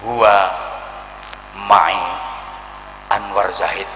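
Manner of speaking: low, reverent plea; solemn intercession. Acted. The Prophet vouching for him, lightly low and solemn. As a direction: answer calmly in reverence.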